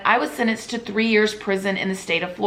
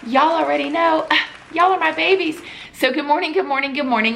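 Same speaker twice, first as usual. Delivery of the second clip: gushing high greeting; 7 semitones higher, faster than usual. Real, not acted. Sweet greeting to viewers.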